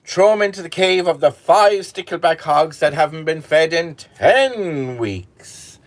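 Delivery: high, loud raging order; furious king. Acted. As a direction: spit out the sentence in fury. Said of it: "The king was furious".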